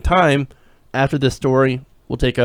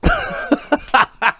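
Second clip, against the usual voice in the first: laughter. Real, not acted. Host laughs at clip.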